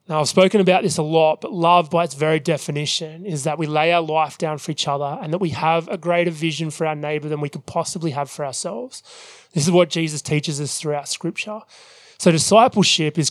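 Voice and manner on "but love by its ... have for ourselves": ordinary delivery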